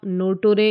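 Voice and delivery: नोटोरे with hard t and sounded re; नोटोरे, every written syllable sounded; wrong literal reading. Shown as a demonstration. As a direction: misread it as नोटोरे, no-TO-ray. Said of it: "नर्टरे नहीं पढ़ेंगे".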